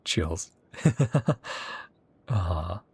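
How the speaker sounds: ordinary talk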